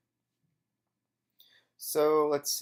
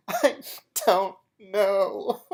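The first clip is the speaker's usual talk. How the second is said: mock crying; 8 semitones higher, 7 dB louder, much slower than usual. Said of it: "I said".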